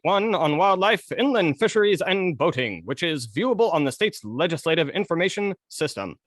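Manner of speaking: mid-Atlantic radio announcer; 1950s narrator. Pretend. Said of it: "The newscast guy?".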